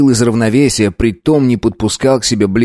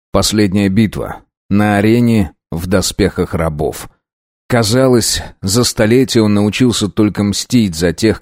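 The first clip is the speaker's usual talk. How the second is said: ordinary delivery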